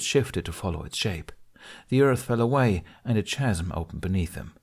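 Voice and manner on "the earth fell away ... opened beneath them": plain reading voice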